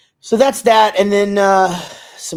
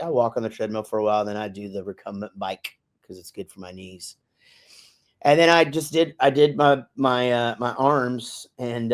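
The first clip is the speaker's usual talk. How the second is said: ordinary talk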